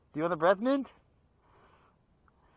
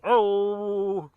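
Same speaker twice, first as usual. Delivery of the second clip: high, loud mock talk-show sidekick "Oh!"; hammed-up imitation of a sidekick's hearty laugh; Ed McMahon laugh; 7 semitones higher, 8 dB louder than usual. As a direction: blurt it out like a sidekick cracking up. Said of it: "He's like Ed McMahon from the Johnny Carson show".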